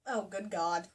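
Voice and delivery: ordinary delivery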